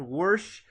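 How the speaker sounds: "wash" with an added R, as warsh; Appalachian R. Shown as a demonstration. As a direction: put an R before the SH in "wash". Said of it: "add an R to wash".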